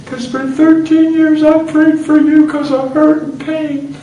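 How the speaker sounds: very high, loud, slow mock-crying; hammed-up high sobbing voice; tearful confession. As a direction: wail it out, imitating a weeping wife. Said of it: "She looked at me and said".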